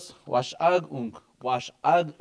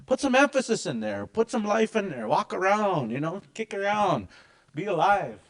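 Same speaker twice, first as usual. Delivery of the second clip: higher, lively pep talk; energetic coaching; 6 semitones higher than usual. Retold or imitated. Cousin urging the teacher to liven up, energetic. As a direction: pump some life into it. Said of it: "He said".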